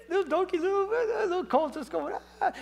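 put-on high, squeaky baby-animal voice; high-pitched donkey mockery; comic little colt. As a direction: play it tiny and silly, half laughing.

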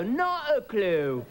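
drawn-out English pilot impression; English pilot accent. English pilots answer a passenger, slow mock English accent. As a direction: play a clueless English pilot, slow and stretched. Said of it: "These three English guys are up in the cockpit".

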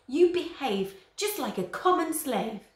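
high, loud, slow rebuke; angry scolding. A narrator's reading. Father scolding son for racing chariots. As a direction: scold him like a slave. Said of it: "snapped Chrysius".

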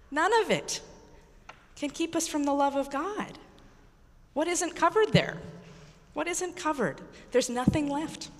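normal talking voice

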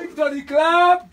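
loud, high, stretched-out cue; rallying shout. Real, not acted. Leader calling the congregation to clap, rallying shout. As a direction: shout slowly for a big clap.

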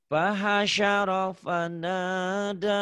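"Pahasyaro fanada" recited slowly with a clear sh sound; slow Quranic model of "Pahasyaro fanada"; Quran recitation model. Shown as a demonstration. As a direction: chant "Pahasyaro fanada" slowly and high, leaning on sh. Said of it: "Ini berarti shin".

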